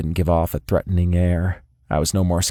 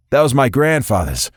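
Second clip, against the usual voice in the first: higher, louder upset protest; 5 semitones higher, 4 dB louder than usual. A narrator's reading. Lorenzo protests as the monkey steals his watch, louder. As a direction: call out after the thief.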